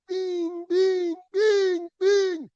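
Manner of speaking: high, loud bell-like bings; ringing pin sound. Retold or imitated. Climber imitating the pin ringing under the hammer.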